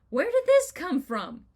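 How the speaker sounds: high, loud bewildered question; bewildered outburst. Retold or imitated. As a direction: blurt it out in sudden alarm. Bewildered by sudden anxiety.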